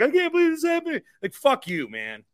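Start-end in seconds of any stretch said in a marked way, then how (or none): 0.0-1.0 high, whiny mock-fan voice; mocking Heat fans. Retelling Heat fans in Butler's face, mocking falsetto taunt. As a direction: sneer it like a gloating fan. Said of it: "some Heat fans, like, in his face".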